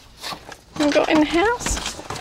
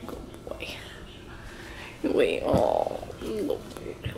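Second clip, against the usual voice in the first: whispering; 12 semitones lower, 9 dB quieter than usual. Real, not acted. Cooing at dog.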